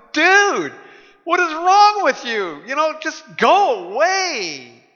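high, drawn-out exasperated plea. Retold or imitated. Dinner guests reacting to an obnoxious stranger, exasperated. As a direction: imitate fed-up guests, pitched high and dragging the last words. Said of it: "shaking their heads and going".

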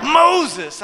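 loud, high exclamation of the name; punchline shouted with delight; amazed. Real, not acted. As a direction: cry out the name in disbelief. Repeats the surprising name for effect.

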